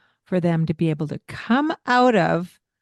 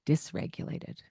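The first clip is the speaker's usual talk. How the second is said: whispered word; 2 semitones lower, 10 dB quieter than usual. Real, not acted. Dramatic word ending.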